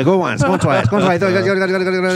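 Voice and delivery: high, rapid auction calls; auctioneer. Pretend. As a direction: hurry the bidding along. Joins auction calling.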